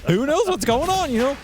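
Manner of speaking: high, loud silly clown voice; Quinn clown voice. Retold or imitated. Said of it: "That's a Quinn impression for you. He loves doing the clown voice".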